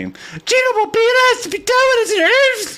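high, loud rock voice; talking rock. Acted. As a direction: belt it like a rocker. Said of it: "I didn't know I had that in me".

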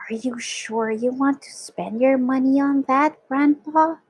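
ordinary delivery

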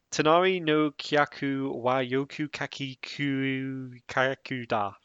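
Tonari no Kyaku wa Yoku Kaki Kuu Kaku da said slow and deliberate, each syllable placed; careful second go at Tonari no Kyaku; slow careful Japanese tongue twister. Shown as a demonstration. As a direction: ease through Tonari no Kyaku slowly so it lands. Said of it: "let me try again".

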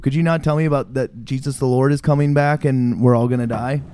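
ordinary talk, nothing marked

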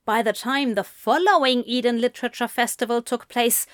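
plain reading voice

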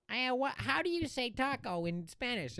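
high cartoon rabbit question; Bugs Bunny. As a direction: imitate a cartoon rabbit's inquiry. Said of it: "And Bugs Bunny goes".

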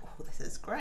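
breathy whisper of pleasure; whispered contentment; whispered delight. Retold or imitated. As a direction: hush it like a blissful sigh. Retold pleasure at hot towel.

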